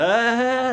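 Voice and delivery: long, loud, high prankster cry; imitated reveal yell, held out; mock host's "ah". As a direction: burst in like a hidden-camera host. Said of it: "they always do that".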